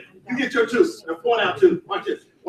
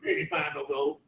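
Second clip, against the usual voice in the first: much lower, much softer doubter scoffing; 9 semitones lower, 13 dB quieter than usual. Retold or imitated. A doubter scoffing at the gold strike, low and hushed. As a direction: brush him off low and quiet, like a disbeliever. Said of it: "What if somebody said".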